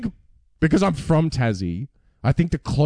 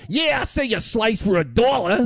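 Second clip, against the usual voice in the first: put-on New York accent; 8 semitones higher than usual. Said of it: "someone who's like".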